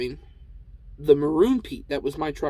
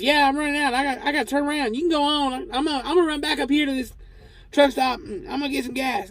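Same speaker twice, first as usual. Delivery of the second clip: put-on much higher Cotton Hill-style old-man voice; [name] as Cotton Hill; 10 semitones higher than usual. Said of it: "apparently I'm gonna do it".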